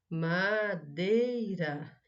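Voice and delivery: "madeira" drawn out as ma-dei-ra; very slow, split "madeira" into syllables. Shown as a demonstration. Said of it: "Separe em sílabas as palavras". ("madeira" bem devagar, sílaba por sílaba)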